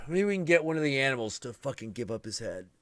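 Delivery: normal talking voice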